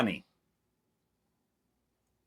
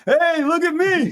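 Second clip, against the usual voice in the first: much higher, slow and loud, hammed-up taunt; mock Doc voice; 14 semitones higher, 5 dB louder than usual. Mocking Doc as he hovers over Marty, higher and louder. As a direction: crow it down from the sky like a showoff.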